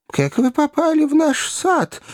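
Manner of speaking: high, timid flustered question; flustered babble. A narrator's reading. The maid asks Pickwick timidly, flustered and high. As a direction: stammer it out, lost and scared. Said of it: "растерянно пролепетала горничная".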